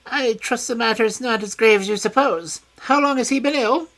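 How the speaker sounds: high, false concern; high voice faking regret; high feigned sympathy. A narrator's reading. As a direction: put on sorrow he does not feel.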